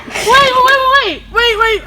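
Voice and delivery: loud, high urgent calls; excited urgent wait. Real, not acted. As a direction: stop everyone at once. Excitedly stopping the cake cutting.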